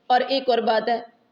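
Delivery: ordinary talk, nothing marked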